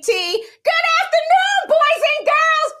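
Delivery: high, slow sing-song greeting; sing-song teacher greeting. Acted. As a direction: greet them like a cheery teacher.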